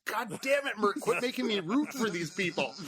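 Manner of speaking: high, hammed-up indignant protest; mock exasperation. Spectator scolding Mert for making him root for them, mock exasperated.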